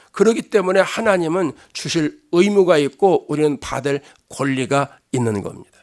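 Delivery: ordinary talk, nothing marked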